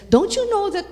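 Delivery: a worried mother scolding her son; exasperated mother. Retold or imitated. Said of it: "and she says".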